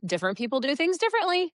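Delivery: high, fast sing-song lilt; sing-songy. Shown as a demonstration. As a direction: chirp it out lightly to mask annoyance. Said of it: "we're saying these sing-songy".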